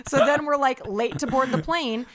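ordinary talk

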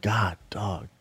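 unvoiced-sounding hushed whisper; whispered awe. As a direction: whisper it under your breath. Imagined reaction to the sight.

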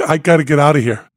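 first ask to leave, fast; urgent but not yet screaming; urgent plea. Retold or imitated. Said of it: "and I'm screaming".

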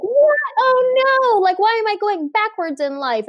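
shocked at suddenly sliding backwards; alarmed surprise. Retold or imitated. Person feeling life go backwards, in high alarmed surprise. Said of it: "and you're like".

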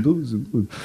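lightly hammed Spanish accent; Antonio Banderas. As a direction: murmur it in a mock Spanish accent. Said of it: "Antonio Banderas sort of accent".

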